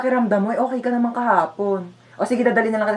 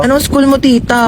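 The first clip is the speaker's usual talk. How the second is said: put-on louder, teasing kid question; 8 dB louder than usual. Kid teasing tita about her school, loud. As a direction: call it out to tease tita.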